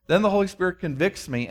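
ordinary talk

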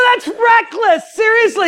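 high, slow hammed-up objection; indignant protest. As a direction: object in scandalized disbelief. Acted protest to pastor.

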